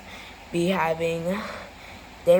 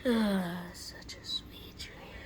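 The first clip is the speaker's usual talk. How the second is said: very soft, slow dreamy murmur; sleepy whisper; 3 semitones lower, 11 dB quieter, slower than usual. Acted. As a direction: breathe it out half asleep. Sleeper sighs happily in dream.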